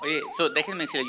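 breathless, high-pitched; catching breath while talking. Real, not acted. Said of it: "Respira, respira".

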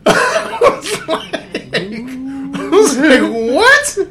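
high, loud, slowed and laughing, then a yelped What; shocked. Retold or imitated. Hearing what the girl said, shocked laughing What.

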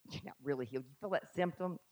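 quiet, sly tempter voice; hiss-free soft taunting doubt; quiet mocking enemy voice. Voicing the enemy's whisper.

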